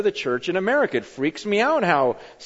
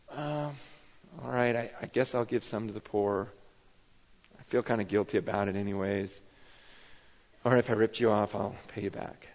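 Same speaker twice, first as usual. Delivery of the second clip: reluctant giving; 9 semitones lower, 10 dB quieter than usual. Pretend. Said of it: "slowly climbing down the tree and going".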